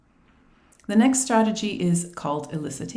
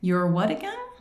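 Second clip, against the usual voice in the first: puzzled teacher; slower than usual. Acted. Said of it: "The teacher would say".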